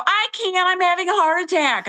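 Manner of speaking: high, breathless plea of a panicking patient; telling the clerk she can't wear a mask, panicked and high. Retold or imitated. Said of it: "And I go".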